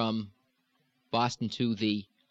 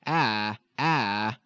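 lilting vowel demo. Shown as a demonstration. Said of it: "We frequently think of the".

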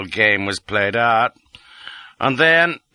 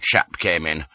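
plain reading voice

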